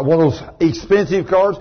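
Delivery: ordinary talk, nothing marked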